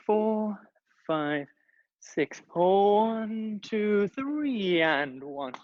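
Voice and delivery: counting out while dancing; dancing while talking. Real, not acted. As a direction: call the counts mid-dance. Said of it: "very difficult to talk and dance".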